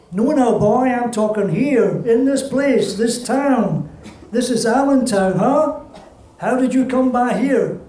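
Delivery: lightly voiced older woman holding court; a touch of a proud old accordion player; old accordionist woman. Accordionist presses the boy.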